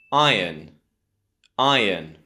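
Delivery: "iron" as EYE-un; British "iron", stressed eye then weak un; correct iron. Shown as a demonstration. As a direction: model "iron" with no r sound. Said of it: "Option B".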